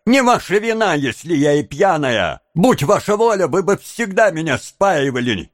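high, heated reproach; embittered high-pitched taunting; bitter. A narrator's reading. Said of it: "с горечью воскликнула девушка".

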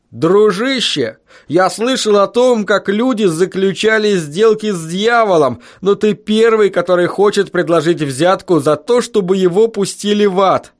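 high-pitched, amused foreman's tirade; jovial scoffing road foreman. A narrator's reading. Foreman mocks the teacher for bribing his way into hell, jovial.

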